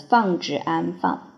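ordinary talk

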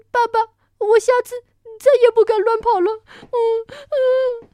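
put-on high, loud, tearful child's apology; scolded little dinosaur promising to behave; crying child. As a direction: whimper it out through sobs. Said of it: "别哭了".

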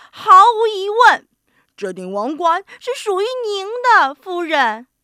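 high, loud proclamation; emphatic acclaim. A narrator's reading. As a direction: declare it with flourish. Animals proclaim the woman the winner.

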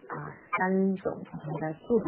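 ordinary talk, nothing marked

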